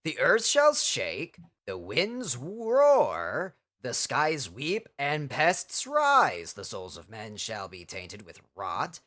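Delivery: slow, low, doom-laden recitation; ominous prophecy reading. Acted. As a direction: intone it like an ancient prophecy. Reads game prophecy ominously.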